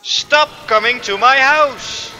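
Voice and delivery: high, loud frustrated yell; loud fed-up outburst; angry shout. Real, not acted. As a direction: yell it at the enemies. Enemies keep attacking his spot.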